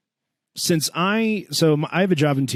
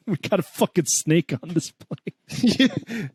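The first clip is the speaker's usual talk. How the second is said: mock grandpa; 4 semitones higher, slower than usual. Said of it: "imagining my grandpa going".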